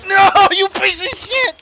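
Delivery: high, loud cry of protest; outraged shout. Real, not acted. Player cursing the opponent who hit him, outraged shout. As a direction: yell it in real frustration.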